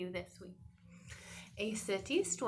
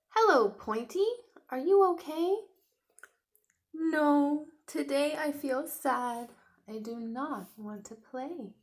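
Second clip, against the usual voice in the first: hammed-up young dinosaur, sorry for himself; little dinosaur voice; 3 semitones higher, 7 dB louder than usual. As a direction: play the sad little T-Rex. Voices Tiny Rex reading story.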